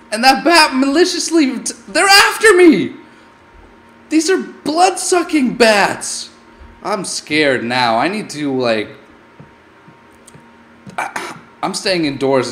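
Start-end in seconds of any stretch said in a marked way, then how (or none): 0.1-6.3 excited. Real, not acted. Retelling bat attack.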